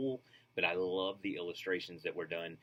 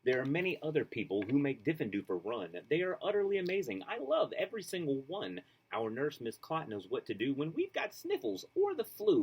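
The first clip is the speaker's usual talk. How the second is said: put-on lively, sing-song reading to children; storybook read-aloud; 6 semitones higher, 3 dB louder than usual. A narrator's reading. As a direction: read it like a bedtime picture book. Reads picture book aloud.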